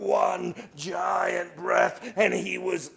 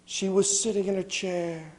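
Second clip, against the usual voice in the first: ordinary delivery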